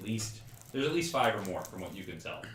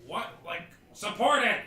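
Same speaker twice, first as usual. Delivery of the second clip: high, loud barked order; yelling foreman; 10 semitones higher, 5 dB louder than usual. Acted. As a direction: shout it like a construction boss. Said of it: "like, a foreman that's, like, yelling".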